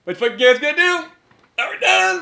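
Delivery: high, loud mock agitation; hammed-up outburst at a high pitch. Mock re-enactment of movie outburst.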